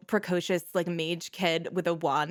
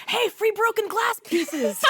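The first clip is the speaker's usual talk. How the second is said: put-on high, loud child's holler; excited kids; 6 dB louder than usual. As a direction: call out like a kid spotting free treasure. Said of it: "You hear the kids go".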